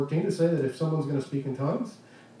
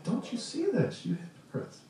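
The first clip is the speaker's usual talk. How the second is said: pleading, brokenhearted; 9 semitones higher, 5 dB quieter than usual. Shown as a demonstration. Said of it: "Almost brokenhearted".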